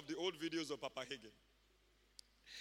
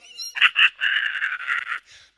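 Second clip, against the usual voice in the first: loud, high put-on cackling; imitated crowd laughter; 15 dB louder than usual. Crowd catching Papa Hagin's joy, imitated loud laughter. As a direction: mimic people catching the giggles. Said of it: "all the people around him start laughing".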